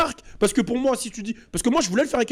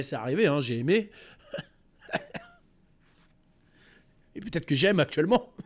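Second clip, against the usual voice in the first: ordinary talk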